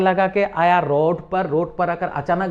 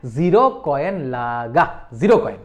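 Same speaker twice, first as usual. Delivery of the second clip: slow, lower teacher repeat for students; slow demo; 6 semitones lower than usual. Shown as a demonstration. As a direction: say it slowly so they can copy. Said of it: "मैं जो word बोलूंगा वही बोलना है".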